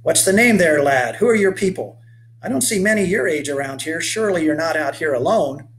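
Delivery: rugged 1830s settler hailing a stranger; weathered old boatman. Acted. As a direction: call out to the boy like a sun-burned old sailor. Questions the strange boy.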